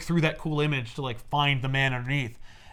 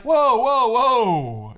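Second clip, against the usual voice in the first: loud, high, startled whoas; alarmed whoa; 8 semitones higher, 7 dB louder, much slower than usual. Retold or imitated. Preston stops Lady Clay's self-hatred, loud and alarmed. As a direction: stop him short in alarm.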